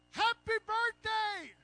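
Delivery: high, slow, loud happy shout; yelled greeting. Retold or imitated. Women at a party yelling at Agnes, joyful shout. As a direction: yell it out like a surprise party crowd. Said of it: "and they yell out".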